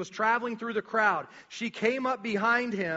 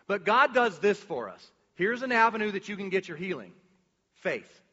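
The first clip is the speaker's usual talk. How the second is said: ordinary talk, nothing marked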